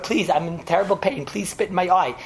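ordinary talk, nothing marked

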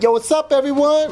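breezy high "Yo, what's up" announcer; casual pilot. Retold or imitated. Pilot greeting passengers too casually. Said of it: "in English, it's like".

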